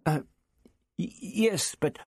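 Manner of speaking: frightened, halting stutter; nervous stammer. A narrator's reading. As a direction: stumble timidly over the answer. Frightened captive answers alien interrogator.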